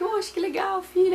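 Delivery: high, happy exclamation; husband's joy. Retold or imitated. Husband greets the newborn, high and joyful. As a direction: voice the overjoyed husband, pitched high.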